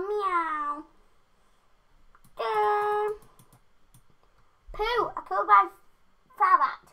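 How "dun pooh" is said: normal talking voice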